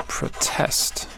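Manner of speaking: whispered protest, proTEST; whispered verb. Shown as a demonstration. Teacher whispering the verb, quiet. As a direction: breathe proTEST while writing. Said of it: "starting with the verb?".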